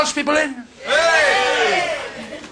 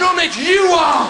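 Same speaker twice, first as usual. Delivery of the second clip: mock retort; 5 semitones higher, slower than usual. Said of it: "I say".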